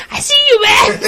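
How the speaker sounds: a playful high-pitched threat as Harley; Harley Quinn voice. Retold or imitated. Harley Quinn spots Batman again.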